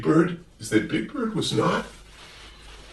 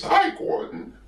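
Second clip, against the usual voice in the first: much higher, chirpy bird greeting; high Big Bird voice; slower than usual. Acted.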